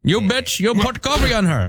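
put-on high angry German accent. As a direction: yell like a German driver. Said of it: "do it like the Germans do".

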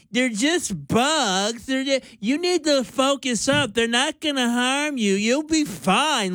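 imitating a condescending co-host; mocking quote. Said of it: "And you said".

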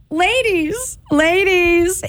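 high, excited ladies; rally the women, thrilled; high excited address; excited call-out. Real, not acted. Calling out to women listeners.

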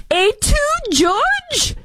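very high, slow hammed-up betrayed cry; mock dramatic. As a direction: ham up a wounded Caesar line. Mock Caesar line.

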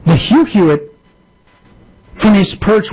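ordinary delivery

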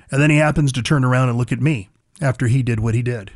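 usual speaking voice

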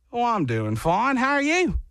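put-on Australian lilt, rising at the end; fake Australian accent. He answers strangers for no reason, fake Australian accent. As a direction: slip into a bogus Australian accent. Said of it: "go with any of those accents".

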